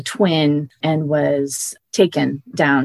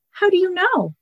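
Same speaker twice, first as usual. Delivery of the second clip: put-on much higher, alarmed boy's voice; boy Shasta. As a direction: protest high and fast like a scared boy. Shasta questions the voice.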